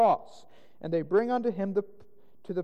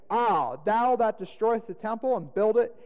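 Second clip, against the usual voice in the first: raised, louder jeer from passers-by; railing taunt; 6 semitones higher, 4 dB louder than usual. A narrator's reading. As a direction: rail at him, high and emphatic. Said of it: "railed on him".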